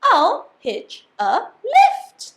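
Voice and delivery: high, loud eureka; excited cry. Acted. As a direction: shout it as the idea hits. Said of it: "then cried".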